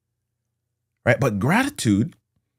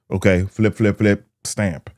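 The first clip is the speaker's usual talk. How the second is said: ordinary talk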